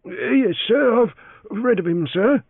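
high deferential servant; elderly valet voice. Acted. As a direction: answer humbly as the valet. Valet answers.

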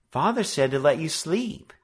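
put-on high girlish voice; little sister answering; girl's voice. A narrator's reading. Bessie answers through door.

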